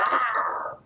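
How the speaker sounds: high growled scream, imitating Wolverine; mock waking scream. Reviewer mimicking Wolverine waking from a nightmare, mock scream. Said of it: "he wakes up screaming".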